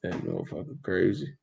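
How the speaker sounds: soft, muttered comment; quiet mock reply; muttered aside. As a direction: say it under your breath. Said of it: "I'm like".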